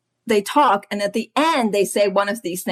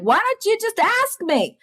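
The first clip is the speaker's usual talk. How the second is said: much higher-pitched mock surprise; imitated incredulous host; 13 semitones higher than usual. Parent to a visiting neighborhood kid, high imitated incredulity. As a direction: put on a high, coaxing parent voice.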